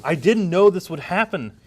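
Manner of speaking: hammed-up soap-opera panic; over-the-top dramatic reading; desperate denial. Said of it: "And scene".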